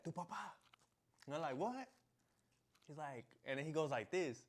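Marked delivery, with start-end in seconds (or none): 0.0-0.5 put-on Tu papá said higher, in a Spanish accent; imitated Spanish. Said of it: "He said it like that". 1.6-1.9 high, rising, baffled What; confused what. Retold or imitated. Confused reply to stepbrother.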